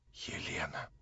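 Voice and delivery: barely audible, low murmur; very quiet whisper. A narrator's reading. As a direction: let it slip out extremely softly. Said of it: "вдруг прошептала она неожиданно и чрезвычайно тихо".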